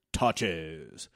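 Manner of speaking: low, drawn-out "tachos" like Snake; Snake from The Simpsons. Shown as a demonstration. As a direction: say "tachos" deep and lazy like Snake. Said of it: "you say it like Snake from The Simpsons".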